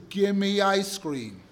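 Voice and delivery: put-on loud, slow, bossy kid's demand; a spoiled child barking an order; pushy little kid voice. Difficult child demanding from parent.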